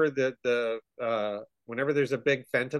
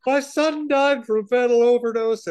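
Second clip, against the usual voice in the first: mock grieving dad; 8 semitones higher, 6 dB louder than usual. Voicing the grieving dad at hearing.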